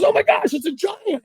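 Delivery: high-pitched amazed outburst; excited imitation. Partner fights another big fish, thrilled. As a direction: imitate an angler thrilled by a giant.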